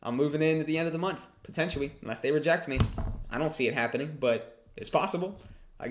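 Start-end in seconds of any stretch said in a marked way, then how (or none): none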